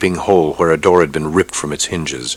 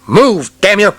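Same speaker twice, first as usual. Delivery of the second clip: high, loud fast shout; 6 dB louder, slower than usual. Acted. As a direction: yell the order over gunfire. Shouting at soldiers.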